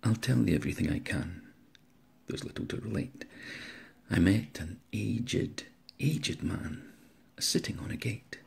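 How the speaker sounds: theatrical, varied recital of a comic poem; poem recitation. Acted. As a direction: perform the ballad with relish.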